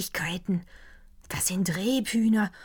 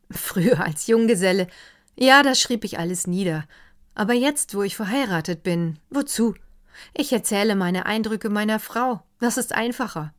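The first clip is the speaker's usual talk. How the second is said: a lightly voiced doctor, higher and louder, carried away; enthusiastic doctor; 4 semitones higher, 7 dB louder than usual. Doctor raving to the counts over dinner, higher and louder. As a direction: let the doctor gush over the dark forest. Doctor explains about his wife.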